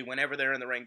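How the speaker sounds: normal talking voice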